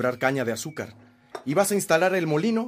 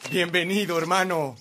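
high, called-out welcome; hearty greeting; 7 semitones higher, 4 dB louder than usual. Acted. Brother welcomes Rafael on arrival, calling out. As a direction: call the welcome across the yard.

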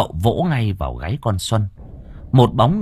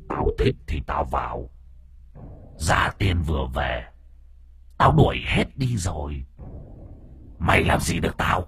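lower, softer demon voice taunting; a spirit sneering from inside the girl; 5 semitones lower, 5 dB quieter than usual. Acted. The demon in Xuân defies the master, low and mocking.

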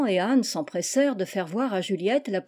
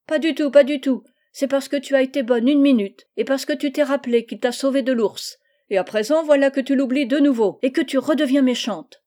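a lightly voiced young boy, higher and louder, reproaching; scolding boy; 4 semitones higher, 5 dB louder than usual. Little brother scolding Félicie, higher, louder reproach. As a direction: press the accusation like a cross little brother. Laurent scolds his sister.

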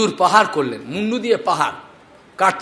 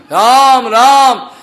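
calling out; 4 semitones higher, 6 dB louder, much slower than usual. Retold or imitated. Mother calling son.

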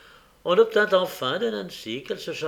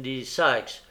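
ordinary delivery

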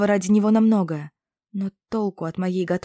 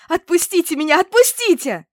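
very high, loud terrified scream; hysterical plea; 11 semitones higher, 9 dB louder than usual. A narrator's reading. Trapped girl screams at the witches to release her, terrified. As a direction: scream to be let go.

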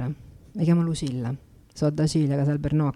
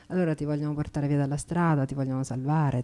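ordinary talk

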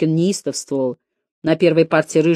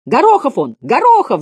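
loud, high shouts from the back; rowdy pupils' yell, pitched up; 8 semitones higher, 5 dB louder than usual. A narrator's reading. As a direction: bawl the name across the classroom. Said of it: "заорали с задних пар".

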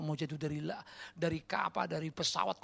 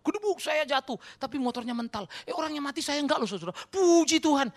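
high, loud testimony parody; hammed-up praise after a crash; mock testimony; 11 semitones higher, 8 dB louder than usual. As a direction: exult like an overexcited believer. Mimicking an excited church testimony.

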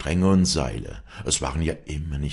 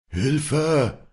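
high, drawn-out faraway call; distant, breath-like cry; 9 semitones higher, 3 dB louder than usual. A narrator's reading. Josef cries from the abyss again, high and distant. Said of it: "klang es wieder wie ein Hauch".

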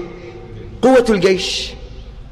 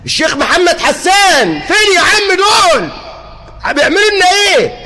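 mock shouted blame; 5 semitones higher than usual. People blaming named sheikh.

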